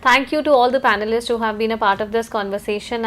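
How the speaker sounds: ordinary delivery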